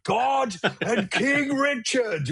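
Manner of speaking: high, slow, drawn-out heroic cry; mock heroic rallying cry. Quoting Prince of Thieves to a tour mate, slow and high heroic. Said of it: "you know Pr-, Prince of Thieves".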